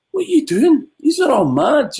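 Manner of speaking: high, baffled mock voice of a pet; animal's disbelief. Voicing a badger judging humans, high and baffled. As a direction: voice a bemused badger staring at people. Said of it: "a badger or a cat or a dog looked at you and gone".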